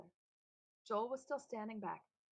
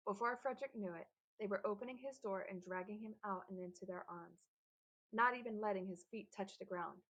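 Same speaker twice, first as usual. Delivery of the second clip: ordinary delivery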